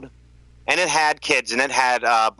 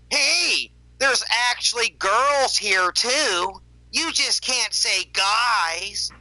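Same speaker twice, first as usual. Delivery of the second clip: higher, slow, snooty imitation of a commenter; mocking chat quote; 7 semitones higher, slower than usual. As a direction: drag it out in a high mocking voice. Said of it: "Someone in the chat actually put in full capitals".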